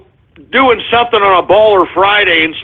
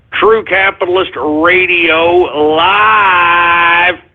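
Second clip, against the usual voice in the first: announcer voice; 2 semitones lower, much slower than usual. Acted. Announces show title.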